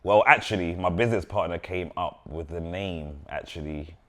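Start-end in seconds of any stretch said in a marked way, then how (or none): none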